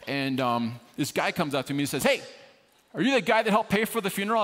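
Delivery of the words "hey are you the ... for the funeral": loud, high confronting shout; tough guy calling out. Retold or imitated. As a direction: bark the question like a tough stranger. Tough stranger confronts him at funeral.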